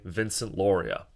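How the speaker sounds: ordinary delivery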